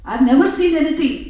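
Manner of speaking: loud, fast indignant outburst; angry retort. Acted. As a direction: snap back at the liar. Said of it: "this frog got very angry".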